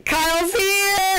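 high, slow sing-song call; sing-song announcement. Pretend. Vlogger greets her friend Kyle on camera, high slow sing-song. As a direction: announce him like a herald, drawn out.

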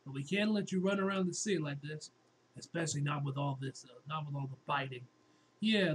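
ordinary talk

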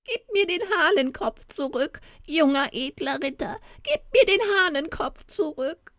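high, whimpering goblin begging; pitiful pleading. Acted. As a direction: beg pitifully for the rooster head. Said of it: "bat er dann mit so kläglicher Stimme".

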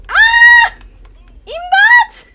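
very loud high screams for help; terrified screams. Real, not acted. Gamer is caught by the killer, terrified screams. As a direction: scream for help as you're grabbed.

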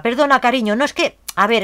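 ordinary delivery

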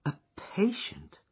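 breathy whispered question, high; surprised whisper. Acted. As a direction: whisper it, dismayed at a late patient. Wife surprised at late caller.

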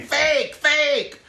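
high, loud squealing fake; earrings screaming. Gaudy earrings shrieking at viewers, high squeal.

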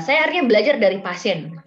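amused, stressed admission; emphatic exclamation. Real, not acted. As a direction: lean hard into "akhirnya". Exclaims, then repeats the phrase.

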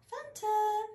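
high, sing-song call of the cat's name; "Phantom" sung up high to coax the cat; sing-song pet call. Real, not acted.

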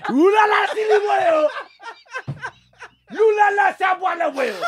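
high-pitched mock wailing rant in gibberish; hammed-up earful. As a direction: shriek an earful in a pretend foreign tongue. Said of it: "poor old Shenda got an earful".

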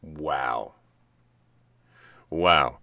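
ordinary talk, nothing marked

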